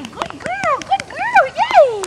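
high squeaky dog praise; squeaky praising a dog. Real, not acted. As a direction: gush over the dog in a squeaky voice. Praising the dog after sitting.

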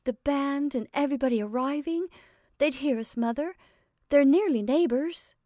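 high, urgent appeal; earnest daughterly plea; pleading. A narrator's reading. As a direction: keep begging mother to see reason. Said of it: "she pleaded".

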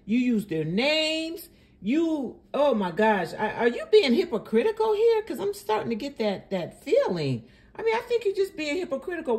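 high-pitched, exasperated accusation; real, worked-up indignation, pitched high; indignant challenge. Real, not acted. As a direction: call out the hypocrisy in disbelief. Indignantly accuses clip's host of hypocrisy.

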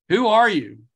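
calling out. Retold or imitated. Climber calls to the unseen stranger, loud. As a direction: shout the question into the fog.

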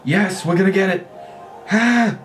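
high, elated victory cries; real burst of triumph, pitch soaring; triumphant cheering. Real, not acted. Gamer finally winning a match, jubilant cheering.

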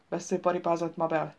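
ordinary delivery, nothing marked

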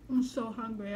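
ordinary delivery

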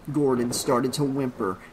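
plain reading voice

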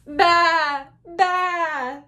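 high, loud storybook baas; sheep bleating. Acted. Teacher voicing the sheep for children, loud bleating. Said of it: "said the sheep".

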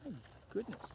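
ordinary delivery

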